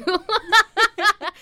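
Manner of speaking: sudden high laugh; laughing out loud. Real, not acted. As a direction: break into a laugh.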